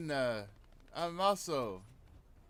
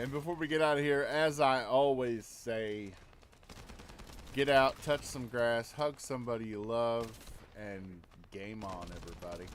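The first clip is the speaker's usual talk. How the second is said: louder upbeat host sign-off; rally the listeners as you close the show; a punchy outro, softening on the last words; podcast sign-off; 3 semitones lower, 4 dB louder than usual. Acted.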